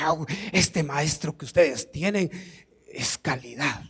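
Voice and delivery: low, soft impressed murmur; acted aside, low and understated; hushed admiration. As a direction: quietly marvel at the teacher. Acts Judas admiring the teacher.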